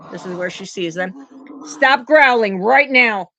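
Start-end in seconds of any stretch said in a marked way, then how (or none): 1.6-3.3 loud, slow scolding of a growling dog. Real, not acted. Owner scolding her growling dog, loud firm stop.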